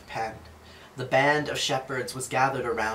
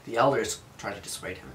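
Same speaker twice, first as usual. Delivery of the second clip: ordinary delivery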